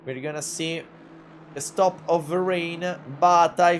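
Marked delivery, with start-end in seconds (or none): none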